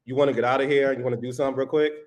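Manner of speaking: low, monotone come-on; put-on bold man. Shown as a demonstration. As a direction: slide in low and smooth to pick someone up. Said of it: "Why the hell are you talking like this now".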